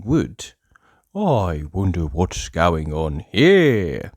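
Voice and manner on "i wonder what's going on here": slow, louder nosy wondering; drawn-out curious musing to himself; curious Mister Nosey. A narrator's reading. Mister Nosey wondering at a closed door, slow and curious.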